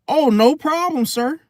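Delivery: high, polite customer-service voice; mock-obliging driver to a customer; reasonable representative voice. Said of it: "my reasonable representative voice".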